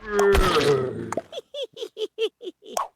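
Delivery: long high laugh; real laugh. Real, not acted. Streamer amused by the game, laughing. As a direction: laugh in high, even pulses.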